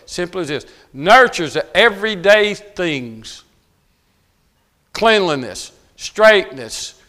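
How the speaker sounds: loud, high, pounding sermon voice; fiery preaching. Acted. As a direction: preach it hard to the fathers. Preaching at full force.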